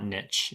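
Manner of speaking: niche as "nitch", short i; American pronunciation. Shown as a demonstration. American settling on the commoner version, niche as nitch. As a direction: say niche to rhyme with ditch. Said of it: "more often than not".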